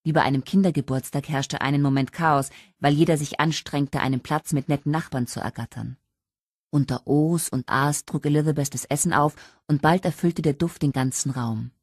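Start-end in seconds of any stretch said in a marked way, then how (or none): none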